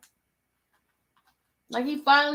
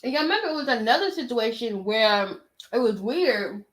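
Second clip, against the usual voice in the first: normal talking voice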